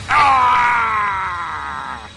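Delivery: pained roar of a hit monster. Acted. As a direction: bellow as the beam strikes. Boss struck by light beam.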